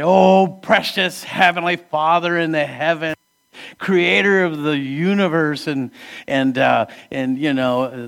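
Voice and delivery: mock pious prayer. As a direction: overdo the lofty prayer like a stiff believer. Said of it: "we get a little bit religious".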